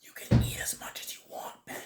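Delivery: soft whisper at the table. Real, not acted. Telling Ben to eat freely, whispered. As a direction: whisper it to your friend. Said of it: "You don't gotta whisper".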